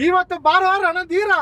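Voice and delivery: slow, high declaimed challenge; film dialogue. Shown as a demonstration. Kidnapper feeding her the next line, drawn-out film dialogue. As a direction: stretch the line out in heroic style. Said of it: "ಒಂದ್ dialogue ಹೊಡೀರಿ".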